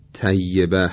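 plain light T in «طیبه», like تیبه; ط مثل ت. Shown as a demonstration. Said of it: "با ته دو نقطه به عنوان مثال بخوایم تلفظ کنیم". («طیبه» با تای نازک دو نقطه)

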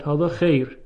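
خیر said as "kheyr"; dialect vowel. Shown as a demonstration. Teacher repeats what dialect speakers say, خیر as "kheyr". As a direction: close خیر to an ey sound. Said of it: "به جای اینکه بگه".

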